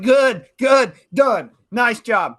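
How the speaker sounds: loud, high barked approval; mimicking a coach's hollered praise; imitated coach's shout. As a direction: shout it like a coach moving players along. Mimicking coach's brisk shouted dismissal.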